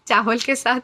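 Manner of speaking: laughing at own joke. Real, not acted. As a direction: let a laugh break into the words. Jokes about thrown tomatoes. (हँसते हुए)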